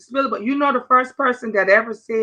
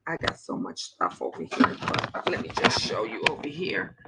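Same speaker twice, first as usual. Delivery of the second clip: hushed whisper aside; 11 semitones lower, 11 dB quieter than usual. Real, not acted. Whispering aside to viewers while showing the room.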